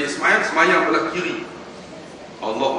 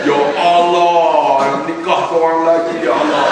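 mock slow prayer; 5 dB louder, slower than usual. Mock husband prays for second wife.

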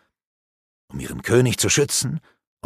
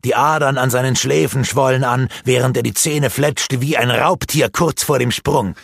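ordinary delivery

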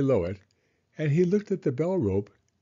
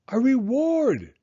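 high, loud and slow; very loudly; 14 semitones higher, 7 dB louder, slower than usual. Acted. Owl loudly repeating to a distracted Pooh. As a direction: bellow the word so he hears. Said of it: "said Owl very loudly".

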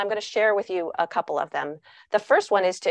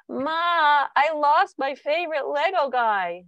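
put-on high, slow little kid voice; child voice; 7 semitones higher, slower than usual. Child telling parent about a lost toy, in a high little kid voice. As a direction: play a small child, upset.

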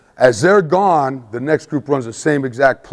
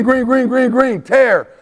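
high repeated green call; 10 semitones higher, 3 dB louder, slower than usual. Retold or imitated. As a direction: bark the color to the line. Quarterback calling play.

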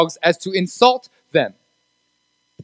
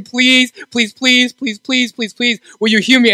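higher pleading voice, over and over; desperate begging; 4 semitones higher, slower than usual. Acted. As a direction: beg at his feet for your daughter. Mother begging Jesus for daughter.

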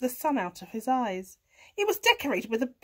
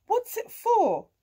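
grunting pig; 10 semitones higher, 6 dB louder than usual. A narrator's reading. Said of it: "grunted Pig".

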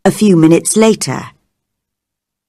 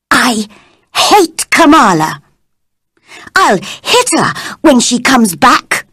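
put-on a touch of tearful childish anger; angry little vow to herself; cross to herself; 4 semitones higher than usual. A narrator's reading. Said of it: "saying crossly to herself".

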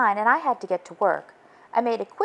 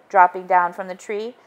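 plain reading voice

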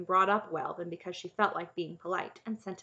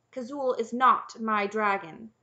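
loud, slow sharp correction; 3 semitones higher, 8 dB louder, slower than usual. A narrator's reading. Princess correcting the knight about her dragon, sharp. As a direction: correct him sharply and deliberately. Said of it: "Cimmerian said sharply".

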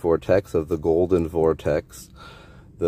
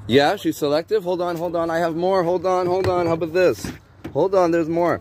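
much higher, urgent sales pitch; eager insistence; 12 semitones higher, 4 dB louder than usual. Real, not acted. Offering more art to a reluctant stranger, high eager insistence. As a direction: keep them from leaving, voice pitched right up.

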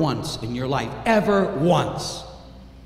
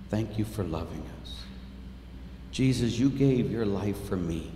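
quiet prayer; 9 semitones lower, 12 dB quieter than usual. Real, not acted. Preacher leading kneeling listeners in soft prayer. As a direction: pray gently with the congregation.

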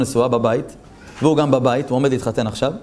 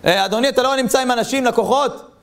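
higher-pitched, mildly acted complaint; indignant customer; 8 semitones higher than usual. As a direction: take offence at being ignored. Acted angry customer in shop.

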